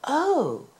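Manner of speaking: high, delighted little "Oh"; an old man overjoyed at the sight; joyful cry. A narrator's reading. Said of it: "cried the old man joyfully".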